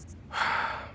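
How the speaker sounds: long breathy sigh; exasperated sigh. Acted. Villain fed up with Rowan's failures, exasperated sigh. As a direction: sigh out your frustration.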